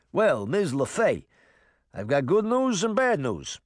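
the cat reports in New Jersey; mildly voiced New Jersey exterminator; New Jersey accent. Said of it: "in a thick New Jersey accent".

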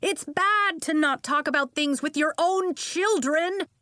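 loud, high scolding of a parent; indignant aunt's yell. A narrator's reading. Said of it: "she yelled to Nori's father".

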